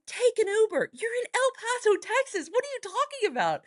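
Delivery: exasperated high plea to sister; exasperated urging. Retold or imitated. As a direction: push her hard, voice shooting up. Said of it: "I was like".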